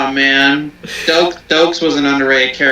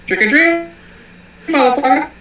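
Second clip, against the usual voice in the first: much higher, playful tough-guy line; mock movie quote; 11 semitones higher than usual. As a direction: toss off the catchphrase in a high jokey voice. Quoting movie line jokingly.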